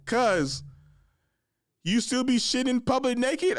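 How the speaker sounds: high-pitched, loud calling out; mock incredulous cousin. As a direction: call out to your cousin in high-pitched disbelief. Cousin's teasing call in retold scene.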